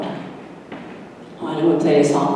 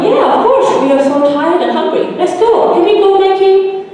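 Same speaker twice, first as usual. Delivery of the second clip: put-on much higher, louder, kids all at once; eager excitement; 6 dB louder than usual. Tired hungry children begging Mikey to go, excited. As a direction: jump at the offer.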